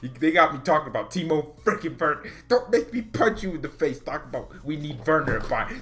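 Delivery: ordinary talk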